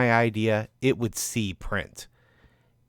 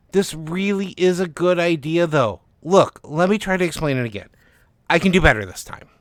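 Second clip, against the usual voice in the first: eager pleading; 6 semitones higher, 6 dB louder than usual. Acted. Author begs for another chance.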